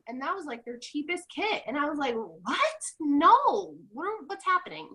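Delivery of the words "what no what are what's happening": high, incredulous swoop; mock disbelief. Recalling her reaction to the kit price, mock disbelief. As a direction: act out a stunned refusal.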